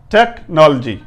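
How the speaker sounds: ordinary delivery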